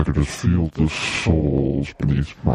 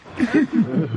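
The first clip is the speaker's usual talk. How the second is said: evil laugh. Pretend.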